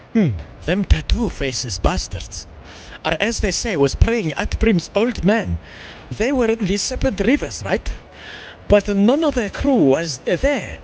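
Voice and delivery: reading quest lines in a put-on English accent. Streamer reading game dialogue aloud in an English accent. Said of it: "that's why I was doing an English accent".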